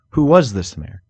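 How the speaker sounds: ordinary delivery, nothing marked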